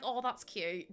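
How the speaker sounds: ordinary talk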